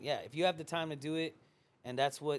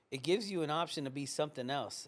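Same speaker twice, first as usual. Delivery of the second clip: ordinary talk, nothing marked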